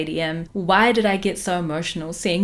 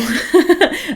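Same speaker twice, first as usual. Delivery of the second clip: a burst of high, loud laughter; 10 semitones higher, 7 dB louder than usual. Real, not acted. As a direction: laugh out at yourself. Laughing at her own gushing.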